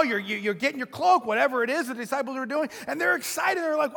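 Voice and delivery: usual speaking voice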